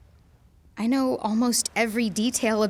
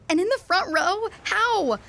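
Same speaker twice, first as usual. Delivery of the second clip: overwhelmed surprise; 10 semitones higher, 3 dB louder than usual. Acted. Overwhelmed by the front-row seats.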